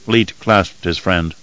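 ordinary delivery, nothing marked

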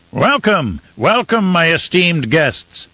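high-pitched, drawn-out ceremonial welcome; grand host's greeting, pitched high and stretched at the end; effusive host. Acted. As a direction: greet esteemed guests as a hammed-up Baron. Baron voiced greeting his banquet guests.

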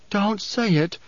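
high, distressed moan; pleading moan. A narrator's reading. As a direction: beg him in a moaning voice. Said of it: "she moaned".

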